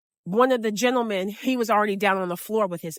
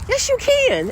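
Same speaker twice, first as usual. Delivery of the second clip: much higher, louder cheer of support; encouraging shout; 5 dB louder, slower than usual. Retold or imitated. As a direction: pump him up. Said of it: "the other guy was like".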